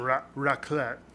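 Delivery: raclette with French pronunciation; a touch of French accent on "raclette". Shown as a demonstration. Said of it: "you could do a French accent".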